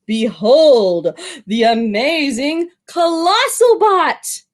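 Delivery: a hint of a proud showman; grand showman. Shopkeeper showing off his best robot, grandly. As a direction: present it with a flourish.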